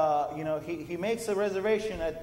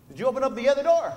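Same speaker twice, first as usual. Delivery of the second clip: faster and louder, pushing the question; pressing question; 6 semitones higher, 7 dB louder, faster than usual. Retold or imitated. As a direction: push him to check the other door. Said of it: "He's like".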